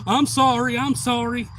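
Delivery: high, loud repeated sorry; acted apology. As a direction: apologize insistently. Acting someone apologizing.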